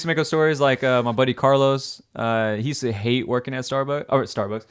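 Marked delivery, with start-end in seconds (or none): none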